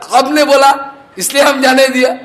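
high, loud, fast, broken Hindi; a servant pleading his innocence; servant's excuse. Retold or imitated. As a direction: protest the order was followed. Servant answers the master.

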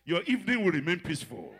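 evening driven out with force. Shown as a demonstration. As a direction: proclaim it, building up. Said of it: "preacher declaring a blessing".

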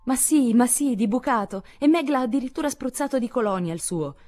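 high, loud reply called over the shoulder; shouting back. A narrator's reading. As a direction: yell back to reassure mother. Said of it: "gridò Jo".

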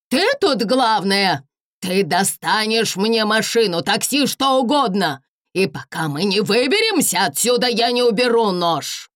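high, loud frantic threat; threatening shout. Acted. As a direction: yell the threat, cornered and wild. Hostage taker demands car.

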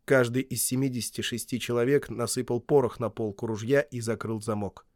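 usual speaking voice

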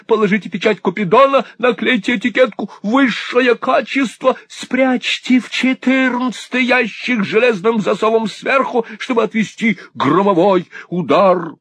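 put-on very high-pitched eccentric old man's commands; giving frantic orders. A narrator's reading. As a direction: reel off orders in a high, urgent voice. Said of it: "Отдавая такие приказы".

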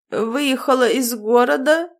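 slow, slurred tipsy voice; drunk slurring. A narrator's reading. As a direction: slur it like a drunk. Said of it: "заплетающимся языком спросил Корвус".

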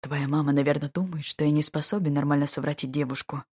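plain reading voice

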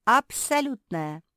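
loud, high, drawn-out confirmation; assuring. A narrator's reading. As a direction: stretch it out emphatically. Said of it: "заверила Куки".

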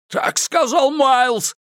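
high, frantic pleading voice; desperate frozen man. Acted. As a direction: beg for life, freezing in the river. Malcolm begs from icy water. (отчаянная мольба замерзающего)

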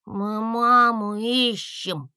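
high, slow and wistful; a small dragon sighing; sighing little dragon. A narrator's reading. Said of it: "вздохнул Гусли".

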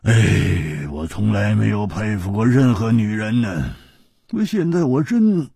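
高而亮的粗豪汉子嗓音，感慨不已; 又佩服又唏嘘地嘟囔; 胡铁花的声音. Acted. 胡铁花的角色声音. (高亢的胡铁花感叹)

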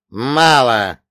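громкий рявк; рявкни коротко; рявкнул. Acted. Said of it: "рявкнул олигарх". (громко рявкнуть)

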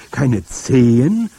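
slow, loud, rising astonishment; astonished exclamation. A narrator's reading. Boy exclaims in surprise at the grandmother's words. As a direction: drag it out in disbelief. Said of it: "rief ich aus".

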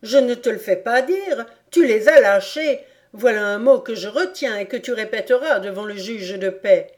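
loud, threatening tirade; a furious farmer threatening the law; angry accusation. Acted. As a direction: pin him down with the word. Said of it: "cria Reb avec colère".

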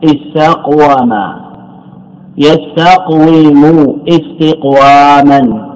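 استقوم يستقوم استقواما stretched very slowly; slow teaching demo. Shown as a demonstration. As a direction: lay out استقوم and its forms slowly for the class. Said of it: "لكن لو قيل استفعل". (نطق بطيء جدا للتعليم)

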